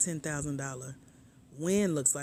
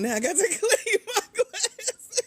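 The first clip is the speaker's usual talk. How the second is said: high, louder words broken by laughs; laughing through tears; 7 dB louder than usual. Real, not acted. Wiping her glasses after laughing at a comment, giggling. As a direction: still giggling as you speak. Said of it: "Now my eyes watered".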